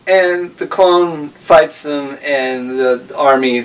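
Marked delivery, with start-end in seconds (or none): none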